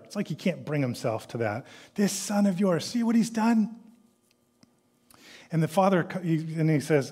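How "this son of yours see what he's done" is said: higher, scornful whine; indignant complaint. Acted. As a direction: sneer it at the father like a wronged son. Mimics son's complaint.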